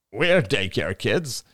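slow, squeezed throat voice; strangled voice. Acted. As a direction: speak with the neck bent tight. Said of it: "His voice sounded strangled from the position".

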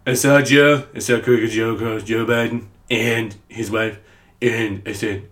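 lightly voiced Trump, slow and boastful; terrible Donald Trump impression. Mocking Trump on Biden, lightly voiced Trump. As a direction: drawl it like a bad Trump. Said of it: "terrible Donald Trump impression".

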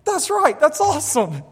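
father praising son's faith, delighted; enthusiastic dad. Retold or imitated. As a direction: cheer your son on. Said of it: "And I was like".

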